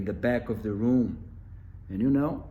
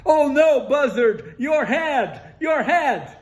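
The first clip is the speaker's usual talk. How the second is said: much higher, louder panicked shout; alarmed cry; 11 dB louder than usual. Acted. Animals shrieking at Buzzard's smoking head, alarmed.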